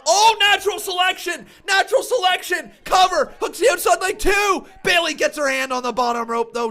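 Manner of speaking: loud, high play-by-play yell at a near fall; excited shout. Real, not acted. Streamer yelling at a near fall on stream. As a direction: call the near fall at full voice. Excited live wrestling call.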